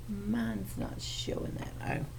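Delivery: soft breathy mutter under the breath; muttered to herself. Real, not acted. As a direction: mutter it to yourself, puzzled. Teacher muttering at her screen.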